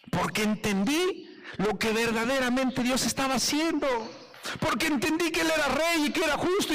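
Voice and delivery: high, impassioned preaching; fervent testimony. Real, not acted. Preacher testifies to congregation about understanding God, fervent. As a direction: proclaim it with rising fervour.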